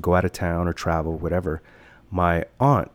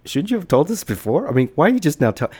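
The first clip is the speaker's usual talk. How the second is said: much higher, louder upset pleading; upset, near tears; 8 semitones higher, 5 dB louder than usual. Retold or imitated. Scared child demanding why mom never told them, high and upset. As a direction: cry out at her, close to tears. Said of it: "I almost started crying".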